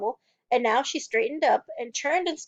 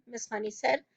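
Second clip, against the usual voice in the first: ordinary delivery, nothing marked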